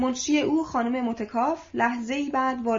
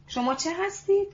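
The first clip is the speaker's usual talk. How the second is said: high, amazed disbelief; astonished question; 6 semitones higher than usual. Acted. Young man asks the manager in disbelief, astonished. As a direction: ask it in sheer astonishment. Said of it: "با حیرت پرسید".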